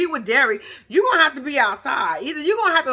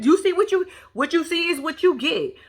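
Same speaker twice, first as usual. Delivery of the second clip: usual speaking voice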